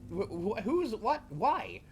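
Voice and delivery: high confused yelping; bewildered. Acted. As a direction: sputter in total confusion. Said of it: "everyone is just like".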